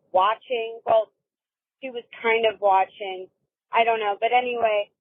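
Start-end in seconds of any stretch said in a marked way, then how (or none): none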